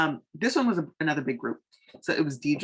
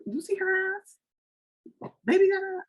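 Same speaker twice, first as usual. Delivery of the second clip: squeaky high mock-dude leer; mock guy catcall. Mocking how guys talk about a girl, high leering parody. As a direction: parody guys ogling, pitched way up. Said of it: "they look at".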